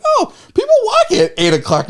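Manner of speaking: high, laughing oh at the late walkers; amused stoned double take; laughing surprise. Retold or imitated. Retelling surprise at late-night dog walkers, laughing.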